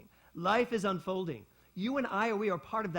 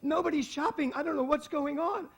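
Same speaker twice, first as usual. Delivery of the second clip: high, loud panicked shriek into a phone; mock a frantic shopper, high and loud; imitating a screaming woman; 10 semitones higher, 8 dB louder than usual. Woman on her cell phone screams to her friend about the empty mall, high and loud. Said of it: "she's screaming at her friend".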